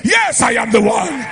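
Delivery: exaggerated shouted self-announcement; mock scream. Shown as a demonstration. Preacher answering a lady on the road, mock screaming. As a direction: bellow it as a joke pulpit voice. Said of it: "be screaming".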